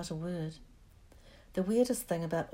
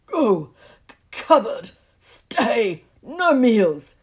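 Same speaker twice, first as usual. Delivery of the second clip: choked, furious shouting; too angry to get words out; strangled voice; 5 semitones higher, 15 dB louder, much slower than usual. Acted. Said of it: "so angry he could hardly speak".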